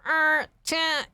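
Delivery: put-on high, loud valley-girl California voice; mock a strong California drawl; strong California accent. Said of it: "they put so much emphasis on".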